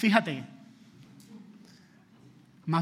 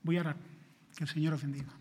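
ordinary talk

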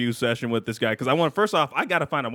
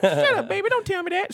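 much higher, sultry radio host impression. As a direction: purr it like a late-night hip-hop DJ. Imitating radio host Precious.

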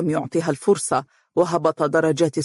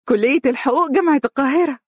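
high and a bit louder, bursting with pride; eager excitement; 9 semitones higher, 3 dB louder, faster than usual. Acted. Mahra shows the acceptance letter to Umm Faris, thrilled. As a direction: announce the news, thrilled and beaming. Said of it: "وقالت بشغف كبير".

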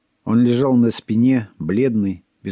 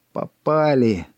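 dismayed exclamation; 4 semitones higher, slower than usual. A narrator's reading. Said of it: "с досадой воскликнул".

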